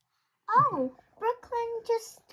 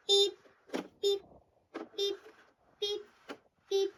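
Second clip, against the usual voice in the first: ordinary delivery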